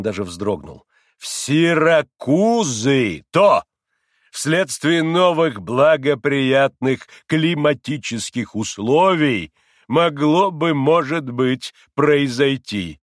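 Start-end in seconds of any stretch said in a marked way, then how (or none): 1.2-13.0 loud, slow, drawn-out pronouncement; self-important, higher-pitched deliberate delivery; pompous doctor. A narrator's reading. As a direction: lecture grandly, stretching the key words. Pompous doctor prescribes to poor man.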